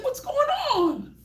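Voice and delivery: high, alarmed question; startled parent. Retold or imitated. Parent hearing a scream, high and alarmed. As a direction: ask in alarm. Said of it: "You're like".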